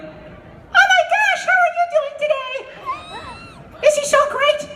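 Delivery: very high, slow squeaky gush; super high squeaky cartoon gush; super high-pitched character. Shown as a demonstration. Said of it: "super high-pitched character".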